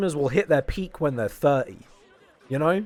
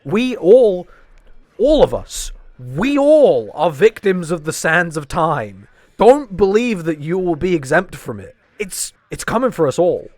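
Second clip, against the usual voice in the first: loud, higher-pitched doom speech; mock-grand proclamation; 4 semitones higher, 8 dB louder than usual. Mock-grand warning about getting old.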